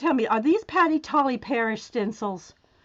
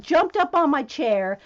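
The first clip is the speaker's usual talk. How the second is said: ordinary talk, nothing marked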